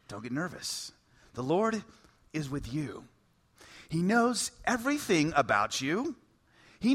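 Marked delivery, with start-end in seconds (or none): 0.1-3.0 quiet. Shown as a demonstration. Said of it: "let me say the angel's words to you".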